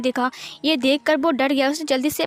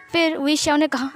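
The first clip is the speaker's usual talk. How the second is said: ordinary delivery, nothing marked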